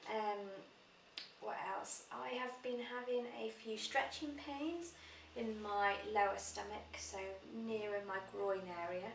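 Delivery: ordinary delivery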